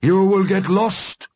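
high, loud, slow warning. A narrator's reading. Mister Grimm warns those leaving, ominous. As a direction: stretch it out ominously. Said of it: "warned Mister Grimm".

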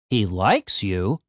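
slow, questioning stress on "likes"; incredulous echo. A narrator's reading. Frank doubts the vampire likes Tim, incredulous. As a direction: draw out "likes" in low disbelief.

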